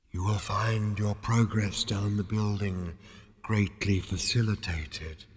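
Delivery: slow, drawn-out spectral guidance; ethereal voice. A narrator's reading. Disembodied voice guiding Zaphod down, ethereal. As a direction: glide through it in unhurried calm. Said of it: "the voice lifted".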